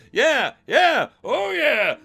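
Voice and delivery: hyped shout. Acted. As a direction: get the crowd going.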